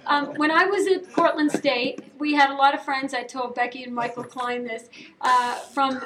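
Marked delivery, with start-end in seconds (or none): none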